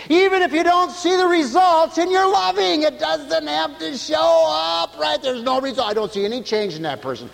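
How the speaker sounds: high, loud, fervent preaching; full-force preaching. Acted. Preacher to his congregation, full-force preaching.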